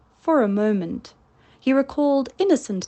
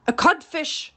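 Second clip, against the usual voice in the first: cheeky, raised reply; taunting answer; 7 semitones higher than usual. A narrator's reading. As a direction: tease him with the insult. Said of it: "replied the voice".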